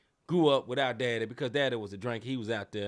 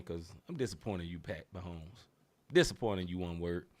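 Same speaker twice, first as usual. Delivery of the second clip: low and much softer; disappointed; 8 semitones lower, 8 dB quieter than usual. Real, not acted. Host signing off on the dad, low and disappointed. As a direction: let the disappointment sink in low.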